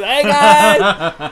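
loud, high, hammed-up party yell; mock partygoer shout. Mocking partygoers bringing a chart to a party, hammed-up shout. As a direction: laugh while hollering like a drunk partygoer.

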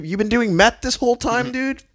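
rising, incredulous accusation; mock upset questioning; pissed disbelief. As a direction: confront a friend, voice climbing high. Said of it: "fucking pissed that you're like".

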